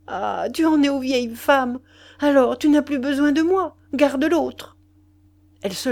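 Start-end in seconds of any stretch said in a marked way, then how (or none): 0.1-4.8 higher and louder, bitter and mocking; bitter taunting. A narrator's reading. Mistress pushing her lover away, bitter, higher and louder. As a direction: throw him back to the old woman. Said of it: "un cri strident de joie nerveuse".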